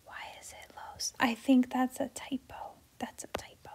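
unvoiced muttered aside, barely audible; whispered aside. Real, not acted. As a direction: whisper to yourself about a typo. Private aside about a typo.

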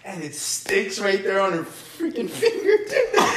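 telling it through real laughter; laughing. Real, not acted. Friend recounting the spitball flying at teacher, laughing. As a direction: struggle to get the story out while cracking up. Cracking up over spitball story.

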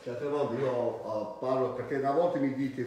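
ordinary delivery

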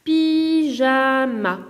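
"Pyjama" very slow: py, ja, ma; split into syllables. Shown as a demonstration. Teacher giving three-syllable examples, "Pyjama" split in three. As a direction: hold each of the three beats of "Pyjama". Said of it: "trois autres exemples".

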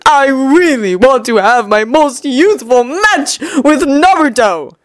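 slow, melodramatic longing; hammed-up mournful yearning. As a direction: lament with stretched-out words. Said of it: "Lee said mournfully".

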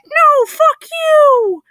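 very high, loud, drawn-out squeal; oddly high-pitched creature. Acted. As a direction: shriek it slowly in a tiny creature's falsetto. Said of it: "in an oddly high-pitched voice".

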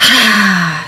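loud sigh on an open ah; open "haa" sigh. Shown as a demonstration. Guide showing another sound to breathe out, open sigh. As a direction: let the breath fall out as "haa". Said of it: "sound, whatever feels good for you".

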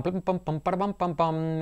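soft sing-song "pam pam pam"; absent-minded pam-pam while searching; bouncy, quiet placeholder syllables; idle "pam pam" filler. Real, not acted. Filler noises while scanning chat.

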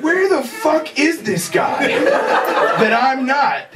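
slow, laughing mock disbelief; incredulous. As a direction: stretch it out in amused disbelief. Incredulous retold inner thought.

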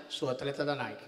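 low, soft flat echo; mock Ronaldinho. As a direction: answer it dull and low. Ronaldinho answering inquiry.

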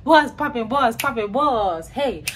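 normal talking voice

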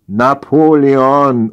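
loud, slow summons to a husband; interrupting call. A narrator's reading. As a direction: call his name across the room, stretching it. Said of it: "unterbrach Sarahs Stimme".

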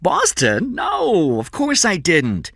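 high, loud protest; indignant denial. A narrator's reading. Cameron denies telling about the Boston deal, indignant. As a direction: deny it in a high, incredulous burst.

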